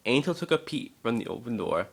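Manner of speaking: plain reading voice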